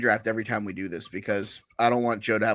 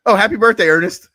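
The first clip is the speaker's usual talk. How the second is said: loud, high birthday cheer; excited; 9 semitones higher, 10 dB louder than usual. Real, not acted. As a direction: burst out with happy birthday. Sudden birthday wish.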